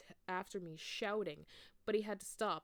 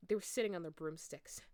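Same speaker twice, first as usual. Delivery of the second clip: ordinary delivery, nothing marked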